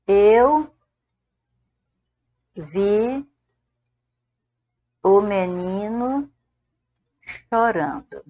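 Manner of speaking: word by word with long pauses; spaced-out dictation pace; slow dictation. Shown as a demonstration. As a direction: dictate each word slowly, pausing between. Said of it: "Aquele é o menino".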